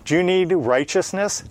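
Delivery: ordinary talk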